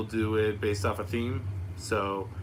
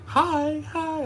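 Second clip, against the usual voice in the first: put-on high sing-song coo at a baby; squeaky baby-greeting coo; cooing baby talk; 6 dB louder than usual.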